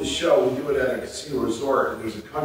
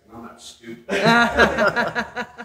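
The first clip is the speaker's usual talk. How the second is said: laughing through the line; 9 semitones higher, 5 dB louder, much slower than usual. Retold or imitated. Retold John punchline, laughing.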